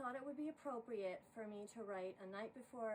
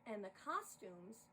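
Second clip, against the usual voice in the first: lively rhyming poem read aloud; poem recital; 4 semitones higher, 5 dB louder than usual. A narrator's reading. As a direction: narrate the verses with bounce and rhythm. Reads a holiday poem aloud.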